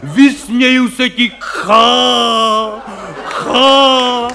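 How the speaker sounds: hammed-up stage hero shouting at death, slow; mock theatrical bluster with a mocking ha ha; mock heroic roar. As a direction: bellow defiance like a ham actor. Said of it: "křičej tam, prostě řvou".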